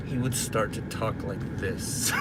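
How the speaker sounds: low, hushed, controlled anger; quiet anger. Shown as a demonstration. Imitating dad when he was angry, quietly menacing. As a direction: drop low and soft, anger held in. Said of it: "When my dad was angry".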